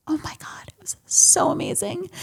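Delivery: a hushed, breathless whisper; awed whisper. Real, not acted. Recalling the moment the baby came out, in an awed whisper. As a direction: whisper it, still in awe.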